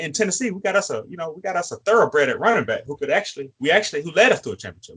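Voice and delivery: ordinary talk, nothing marked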